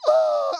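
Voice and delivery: very high, loud weeping wail; mock a hysterically crying girl; imitated hysterical sob. Dad imitating his daughter weeping at the concert, high loud wail. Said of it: "hyperventilating. She was crying so much".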